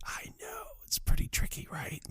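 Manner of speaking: hushed, unvoiced aside; whisper. Cop admitting it to his partner in a whisper. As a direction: keep it a breathy secret. Hiding under bed, whispered reply.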